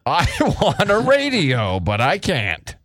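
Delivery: slow mocking lament, falling away at the end; exaggerated slow-talker impression; mimicking co-worker. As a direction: stretch it out in mock self-pity. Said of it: "That's how you sound".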